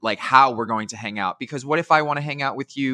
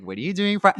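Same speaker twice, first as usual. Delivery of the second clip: higher, half-laughing mock invite; imitating Johnny's take; 6 semitones higher than usual. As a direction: playfully mimic a friend's eager invitation. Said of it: "that's Johnny's take on it. He's like".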